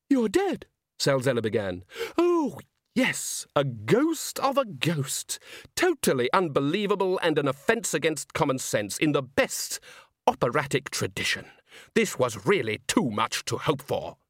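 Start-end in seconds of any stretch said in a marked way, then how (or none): 0.1-0.7 sudden high-pitched astonishment; startled disbelief. Acted. Villain sees the ghost. 2.2-14.2 theatrical relish, starting high; delighted villain. Acted. Salzella delights in the absurdity.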